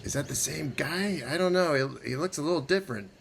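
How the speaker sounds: high-pitched, puzzled sentry; guard calling out. Acted. Guards eyeing the disguised wizard, puzzled calling out. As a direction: puzzle it over, then call the question out. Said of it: "The voice calls out to you".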